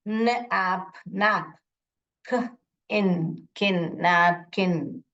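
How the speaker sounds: "napkin" sounded out piece by piece with short vowels; teacher's slow phonics blending of "napkin", short "a" and short "i"; sounding out syllables. Shown as a demonstration. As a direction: slowly blend "N, ap, nap" and "K, in, kin" into "napkin". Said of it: "The vowel will tell its short sound".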